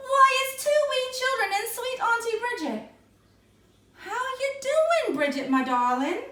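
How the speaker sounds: a high, cheeky little man in green; leprechaun voice. A narrator's reading. Leprechaun greets the children.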